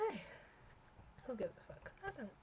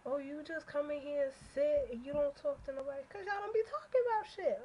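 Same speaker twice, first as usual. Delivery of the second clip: high, mocking voice of chat people; mock the complainers, pitched up; mocking whiny quote; 6 semitones higher, 3 dB louder than usual. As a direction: parrot them back in a high mock whine. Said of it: "people are like".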